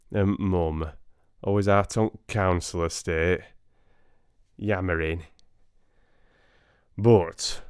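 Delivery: broad Yorkshire storyteller spinning a cheeky Cinderella; Yorkshire accent. Pretend. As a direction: tell it like a Yorkshire lad down the working men's club. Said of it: "read it in Yorkshire".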